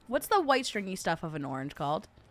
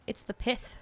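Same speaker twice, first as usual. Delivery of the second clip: normal talking voice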